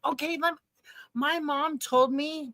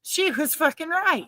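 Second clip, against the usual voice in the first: raised pitch, emphatic conviction; emphatic repeat; 5 semitones higher than usual. Real, not acted. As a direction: repeat it higher, driving the point home.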